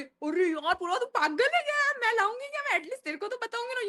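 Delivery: high-pitched, protesting friend; indignant denial. Retold or imitated. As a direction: imitate a roommate defending herself, pitch jumping up. Roommate's indignant denial acted out. (नाराज़ होकर सफ़ाई देने की नकल)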